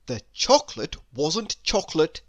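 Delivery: ordinary delivery, nothing marked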